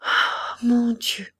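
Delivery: a groan breaking into a cry; muffled lament. Acted. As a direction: lament to God, a touch of a sob. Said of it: "cette lamentation sourde".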